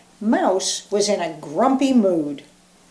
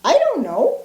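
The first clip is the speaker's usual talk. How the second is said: high mouse squeak; 7 semitones higher, 3 dB louder than usual. Acted. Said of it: "squeaked Mouse".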